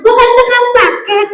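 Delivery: high singsong puppet voice; high-pitched comic echo. Acted. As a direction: parrot it back high like a puppet. Puppet echoes the correction.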